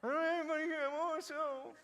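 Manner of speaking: mock-sobbing self-pity; whiner. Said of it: "I know that's how he said it".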